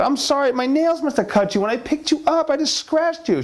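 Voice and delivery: high, sugary husband voice; husband's smooth excuse. Acted. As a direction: pitch up and coax her.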